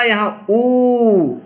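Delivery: ऊ stretched to twice the length; long vowel demo. Shown as a demonstration. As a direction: draw out ऊ long and slow. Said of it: "वहाँ उ बोला".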